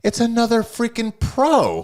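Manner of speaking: loud, high-pitched exclamation of disbelief; mock exasperation. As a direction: cry it out in comic outrage. Retelling his outraged reaction to matchup.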